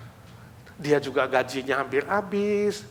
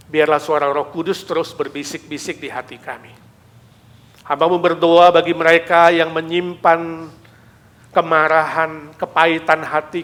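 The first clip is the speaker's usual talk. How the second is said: earnest prayer, swelling louder at Tuhan Yesus; fervent prayer; 9 dB louder than usual. Acted. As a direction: lead the church in prayer. Leads closing prayer.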